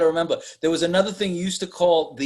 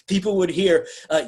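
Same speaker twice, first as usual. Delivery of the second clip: usual speaking voice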